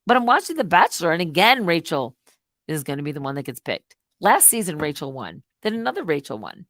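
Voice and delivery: ordinary talk, nothing marked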